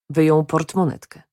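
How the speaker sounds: ordinary delivery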